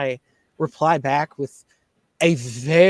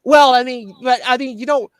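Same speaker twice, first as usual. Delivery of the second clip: higher, louder hesitant backpedalling; mock hedging; 7 semitones higher, 4 dB louder than usual. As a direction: imitate him stammering a lame excuse, pitched up. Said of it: "he's like".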